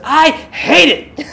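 mock angry outburst, high and loud, drawn out slowly; angry old lady. Old lady answering the stunned song leader, exaggerated anger. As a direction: imitate someone mad, stretching each word high. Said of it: "like, angry, like, visibly, like, mad".

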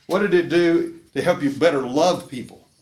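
loud, insistent mock challenge; imitating a doubtful listener. Preacher voices a skeptical listener asking loudly. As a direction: push the question hard like a skeptic in the pews. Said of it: "You say".

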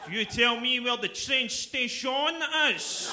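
high, loud, very slow menacing words; slow threatening enunciation. Pretend. Glaswegian reassuring the scared Englishman, slow loud menace. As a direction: spell out each word like a threat.

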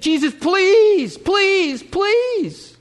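high, drawn-out, loud pleas; desperate begging. Acted. Royal official begging Jesus for his sick son, desperate pleading. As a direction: beg hard, stretching each please. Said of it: "he came and begged".

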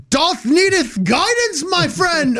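high, slow put-on olde English voice; imitating fair actors, pitched well up and slow; mock fair characters. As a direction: ham up the costumed guides. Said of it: "They just like".

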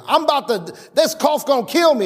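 imitating a dramatic sick person; mock complainer. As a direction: ham up the doom of a cough. Voicing the careless mouth.

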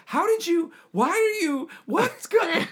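very high baffled voice; high baffled brother imitation. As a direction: stammer in total confusion. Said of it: "he's like".

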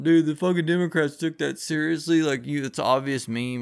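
a hint of a mocking bro voice; mock Republican bro. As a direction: toss it off like a smug dude. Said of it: "Republicans are always going".

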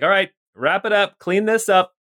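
high, crisp commands; brisk orders. Acted. Scientist orders her team to shut the subject down, crisp. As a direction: bark it out to the lab.

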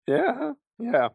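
high, loud startled double yeah. Retold or imitated. Retelling his startled reply to the tough kid, high and loud. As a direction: jump in pitch, caught off guard.

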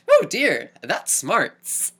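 hammed-up quaint wince of pain; prim storybook gentleman. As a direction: play a dainty hero after getting bonked. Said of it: "His reaction in ... romanticized goggle visions".

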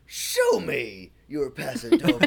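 hammed-up slow movie line; dramatic quote. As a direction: drag it out like a flamboyant dance master. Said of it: "And he says".

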